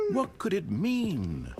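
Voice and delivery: slow, puzzled narrator; story narration. A narrator's reading. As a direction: wonder it aloud slowly. Narrator reads game story.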